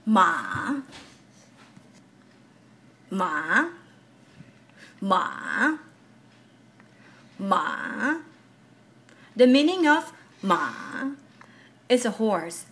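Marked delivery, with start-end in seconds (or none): 0.0-8.2 "Ma" dipping low, then rising back up; third-tone "Ma", a V-shaped pitch. Shown as a demonstration. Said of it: "making your voice dig in the air and rise again". 10.4-10.7 "ma" sinking low, then rising back to mid-range; third-tone "ma" in a V shape; dipping tone. Shown as a demonstration. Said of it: "dips low".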